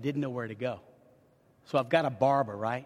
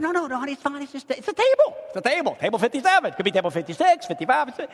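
high, hurried brush-off; nervous fake-casual denial to his girlfriend; flustered denial; 9 semitones higher, 4 dB louder, faster than usual. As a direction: cover the secret, talking too fast and too high. Hiding the proposal from wife.

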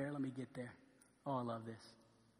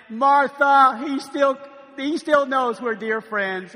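touched excitement; 5 semitones higher, 8 dB louder, much slower than usual. Acted. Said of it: "let's act this thing out".

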